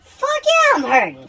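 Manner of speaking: patient yelling to the nurse, high and loud; emphatic yell. Retold or imitated. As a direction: shout the pain out to the nurse. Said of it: "I was like".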